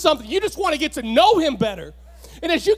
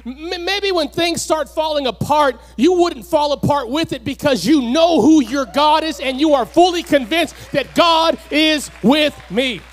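rising, louder preaching build; 3 semitones higher, 4 dB louder than usual. Acted. Preacher urging the congregation, swelling into a shout. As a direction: preach it, climbing to a shout on fully.

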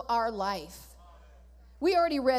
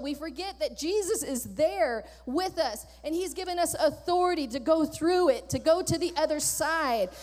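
normal talking voice

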